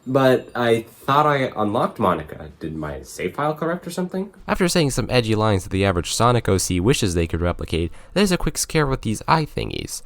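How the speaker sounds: ordinary delivery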